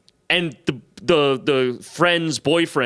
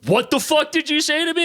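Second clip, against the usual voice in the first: much higher, indignant imitation; retold angry challenge; 13 semitones higher than usual. Huge frat guy confronts a cheeky stranger, mock-outraged. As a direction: challenge him like an offended drunk.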